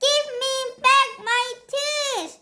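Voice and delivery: Peter pleading. A narrator's reading. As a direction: wail for your tooth back. Peter demanding his tooth.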